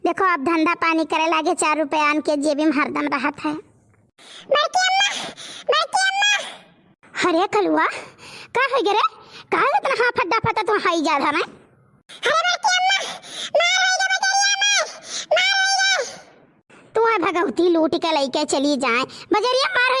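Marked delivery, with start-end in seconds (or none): 3.5-6.3 high urgent call for auntie. Acted. Child runs calling aunt. 12.3-16.0 high frantic cry for help; panicked shout. Acted. Child reports fight, panicked.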